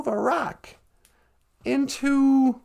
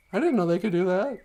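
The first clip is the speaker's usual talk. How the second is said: ordinary talk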